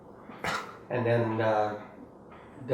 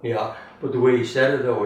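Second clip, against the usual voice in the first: normal talking voice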